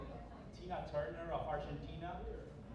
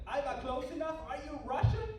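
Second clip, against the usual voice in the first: high, rising mock questioning; baffled questioner; 10 semitones higher, 6 dB louder than usual. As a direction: imitate a confused listener in a much higher pitch. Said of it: "And they go like".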